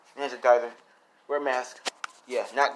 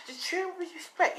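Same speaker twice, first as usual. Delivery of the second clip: high female voice. Acted. Diane scolds Izuku.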